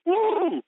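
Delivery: high whooping cheer; hype-man whoop. Real, not acted. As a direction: let out a big woo. Cheering during quote.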